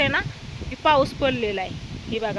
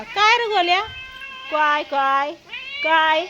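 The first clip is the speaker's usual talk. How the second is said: pet talk; 5 semitones higher than usual. Real, not acted. Calling to her pet.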